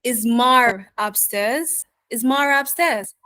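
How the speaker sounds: New York "Is Mar upstairs", mother run into "Mar"; New York accent, linking R in "Mar upstairs". Shown as a demonstration. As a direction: say it like a New Yorker, tone lifting on upstairs. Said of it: "So as a New Yorker, I would change this sentence".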